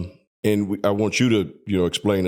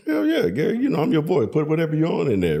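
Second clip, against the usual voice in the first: excited retort; 7 semitones higher, faster than usual. Retold or imitated. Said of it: "I was like".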